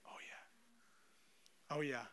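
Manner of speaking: breathy stunned whisper; embarrassed whisper. Acted. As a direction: whisper it, caught out. Realising the dad has no arms.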